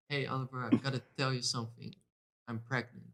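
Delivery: soft, low, acting the girlfriend; hushed pregnancy confession. Girlfriend telling boyfriend she is pregnant, hushed mock confession. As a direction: break big news quietly, play-acted.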